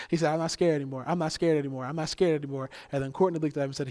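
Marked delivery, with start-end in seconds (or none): 0.3-2.7 loud, excited proclamation, repeated; imitating a child's proud, loud boast; retold triumph. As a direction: crow it like a child who beat a fear. Retold child triumphantly running around.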